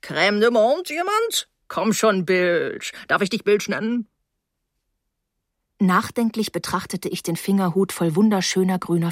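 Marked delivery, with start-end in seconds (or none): 0.0-4.1 lightly voiced hearty host, swooping up on questions; jovial tipsy host. A narrator's reading. Mr Rose offering liqueur to his young guest, jovial. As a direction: play the over-familiar host, lightly put on.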